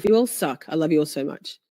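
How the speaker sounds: normal talking voice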